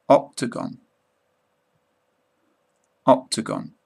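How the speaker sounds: British accent teaching demo, every word clearly said twice; careful British pronunciation. Shown as a demonstration. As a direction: model each word twice for learners to copy, British accent. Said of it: "say each one twice in my British accent".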